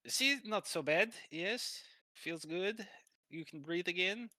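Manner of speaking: reassuring masseur. Acted. Masseur checks on the client afterwards, high coaxing accent. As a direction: coax them in a lifted Russian accent.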